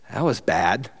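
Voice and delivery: low, soft, acted understatement; subdued child. Other kid after taking medicine, subdued. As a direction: register dislike with minimal fuss.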